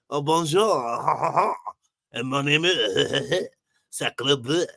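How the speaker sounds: slow, hammed-up French accent. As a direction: play a cartoon French chef. Mock French greeting and name.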